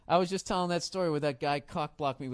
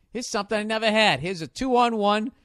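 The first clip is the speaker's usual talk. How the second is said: thrilled inner voice; 6 semitones higher, 5 dB louder than usual. Retold or imitated. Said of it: "I was like".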